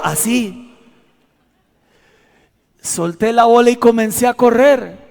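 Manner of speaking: ordinary talk, nothing marked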